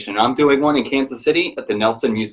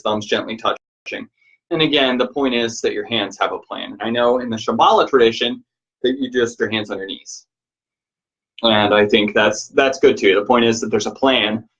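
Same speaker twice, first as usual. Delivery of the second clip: usual speaking voice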